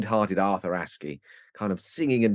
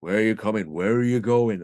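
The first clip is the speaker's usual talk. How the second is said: station guard voice; 3 dB louder than usual. Retold or imitated. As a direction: drag it out like a wary rural porter. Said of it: "He goes".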